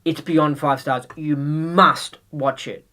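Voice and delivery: slow, deliberate, leaning on must; slow insistence. Pretend. Urging a friend to watch, slow and insistent. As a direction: lay it down like a command. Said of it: "You'll go".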